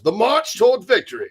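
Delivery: high, proclaiming newsreel voice; wartime commercial announcer. Acted. Host recalls old war commercials, mock announcer voice. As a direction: announce it like a wartime propaganda film. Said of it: "during World War Two, they had those, uh, commercials".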